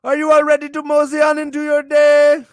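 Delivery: loud, high rally to the crowd; hype shout. Acted. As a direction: fire up the audience. Host shouting to audience.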